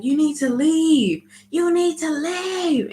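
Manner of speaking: high, slow mock yell of an angry older lady; high shrieking imitation of an old woman yelling; imitated yelling. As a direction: shriek it out like the furious lady ordering him away. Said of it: "she starts yelling".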